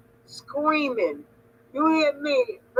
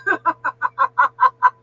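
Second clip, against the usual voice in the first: loud tearful laugh; laughing till she cries after the quote; 3 semitones higher, 4 dB louder than usual. Real, not acted. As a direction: burst out laughing.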